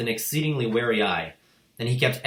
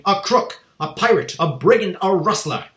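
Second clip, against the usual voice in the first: a much higher, very loud scream; 10 semitones higher, 9 dB louder than usual. A narrator's reading. Headmistress accusing the boy, screaming. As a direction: shriek the accusation.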